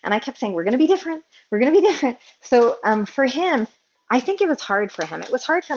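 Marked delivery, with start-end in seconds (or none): 0.5-2.2 higher, emphatic, repeating the vow; insistent promise. Retold or imitated. To her husband before the baby, higher and insistent. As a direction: say it like a determined pledge, a bit louder. Retold self-quote, kept saying.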